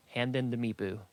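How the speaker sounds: plain reading voice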